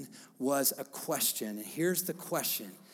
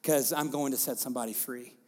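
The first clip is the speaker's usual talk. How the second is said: loud, higher preaching voice building up; full-force preaching; 5 semitones higher, 9 dB louder than usual. Acted. Pastor rallying the congregation about Decapolis, loud preaching. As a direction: preach it out big to the room.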